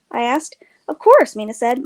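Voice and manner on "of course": high, loud "of course"; bright emphatic reply. A narrator's reading. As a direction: toss it off with brightness. Mina answers matter-of-factly.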